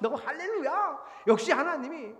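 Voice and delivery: high, loud mock hallelujah; retold gushing thanks. Preacher mimics past self thanking God, mock-elated. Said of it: "그래 또 감사하죠".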